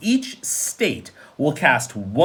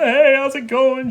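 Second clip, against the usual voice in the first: mock campaigner; 9 semitones higher, 3 dB louder than usual. Mock campaigner greeting voters.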